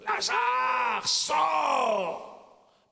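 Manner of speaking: high, ringing command; speaking loudly. Shown as a demonstration. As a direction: shout it out with full force. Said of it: "Il faut être aussi capable de parler fort". (crier fort et haut, comme un ordre puissant)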